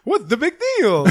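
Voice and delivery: high, goofy cartoon voice breaking into laughter; high-pitched cartoon impression; Roger Rabbit. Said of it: "a Who Framed Roger Rabbit voice".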